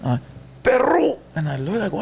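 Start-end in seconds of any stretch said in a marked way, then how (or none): 0.6-1.2 high-pitched drawn holler; imitated call-out. Uncle hailing nephew from the release tank, high call-out. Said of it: "he used to say".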